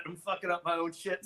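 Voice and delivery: usual speaking voice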